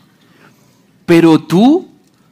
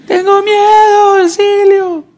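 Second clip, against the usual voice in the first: put-on much higher, slow, louder child's plea; frightened little girl; 5 dB louder, slower than usual. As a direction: beg for help like a terrified small child. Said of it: "mi hija estaba llorando".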